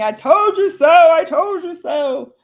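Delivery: high, loud mock outcry; father's mock I-told-you-so. Father rolling in his grave at daughter, mock scolding outcry. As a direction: wail it out as the exasperated father.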